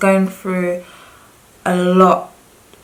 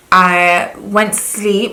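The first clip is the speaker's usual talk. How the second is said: ordinary talk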